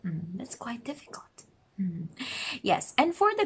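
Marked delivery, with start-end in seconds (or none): 0.1-2.1 whispered, half to herself; whispered aside. Real, not acted. Teacher confiding to viewers about a hard rule, whispered. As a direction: drop to a whisper for a private comment.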